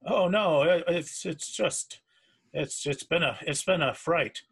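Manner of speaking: flustered, halting start; shaken sailor. Acted. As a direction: stumble over the words, badly rattled. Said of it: "you seem rather shaken".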